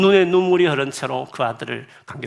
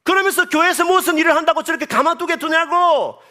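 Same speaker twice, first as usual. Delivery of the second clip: high, raised preaching voice; fervent preaching; 14 semitones higher, 6 dB louder than usual. Acted. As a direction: rebuke the judging believers. Preaching at full force. (높은 목소리로 꾸짖듯 설교하듯)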